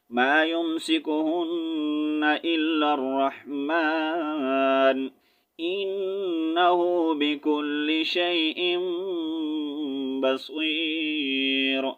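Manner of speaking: melodic tarteel, drawn out; Quran recitation. Acted. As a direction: chant the verse like a qari.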